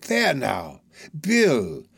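eager soothing protest; calm him down, eagerly; remonstrating. Acted.

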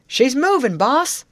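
high, loud shout to the boss; excited shout. A narrator's reading. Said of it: "a shout from".

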